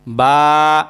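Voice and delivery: বা held low and clear; model letter. Shown as a demonstration. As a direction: lead repetition of বা. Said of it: "আবার বলেন". (বা স্পষ্ট করে টেনে বলা)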